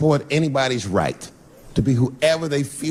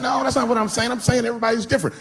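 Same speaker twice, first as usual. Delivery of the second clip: high-pitched, hurried backpedalling; acted defensive protest; 9 semitones higher, faster than usual. Explaining himself to offended friend on phone, defensive protest. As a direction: protest your innocence in a raised pitch.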